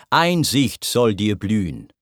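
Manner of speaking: ordinary delivery, nothing marked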